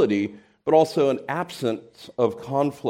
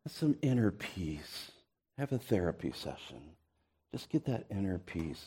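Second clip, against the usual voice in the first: much softer, lower, dreamy mock calm; mock soothing; 5 semitones lower, 13 dB quieter than usual. Preacher mocks the inner-peace idea to the church, softly and dreamily. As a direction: hush it like a parody of a therapy ad.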